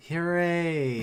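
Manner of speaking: half-hearted drawn-out hooray; mock cheer. Mock cheer after reluctant groan.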